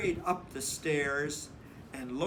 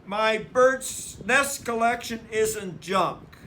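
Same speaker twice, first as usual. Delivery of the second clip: loud, higher protest; yelling; 6 semitones higher, 8 dB louder than usual. A narrator's reading. Brother protesting to Mama, loud yell. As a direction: yell it at the top of your lungs. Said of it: "yelled brother at the top of their lungs".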